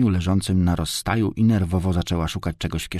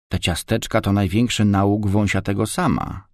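ordinary delivery, nothing marked

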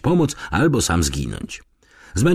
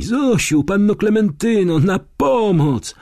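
crying cry for help; 13 semitones higher, 3 dB louder than usual. A narrator's reading. Frightened girl Lonia calls for Zosia and Klementyna, crying out. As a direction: call out desperately in a much higher voice. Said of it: "usłyszałem płacz i krzyk Loni".

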